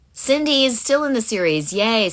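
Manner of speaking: high, bright cheer of news; excited. Real, not acted. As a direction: celebrate the news. Happy news read from chat.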